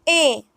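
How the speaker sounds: A said as a pure "eh"; A as one steady e vowel; Spanish letter E. Shown as a demonstration. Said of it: "E is pronounced as".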